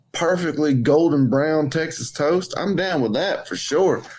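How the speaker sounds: ordinary talk, nothing marked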